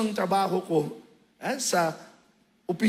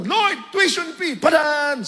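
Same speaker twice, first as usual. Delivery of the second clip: louder, higher comic demand; mock shout; 6 semitones higher, 7 dB louder, slower than usual. Mocking a lazy prayer to the Lord, a comic shout. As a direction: yell it up at heaven like an order.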